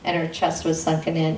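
ordinary delivery

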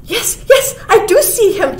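high, loud, excited squirrel; chattering squirrel. A narrator's reading. Squirrel who has spotted the stranger, high chattering. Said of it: "chattered the squirrel".

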